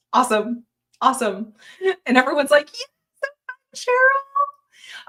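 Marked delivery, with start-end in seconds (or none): 2.7-5.1 squeaky, high chirp of a happy viewer; high mock chat voice. Reading a viewer's happy comment in a high chirp.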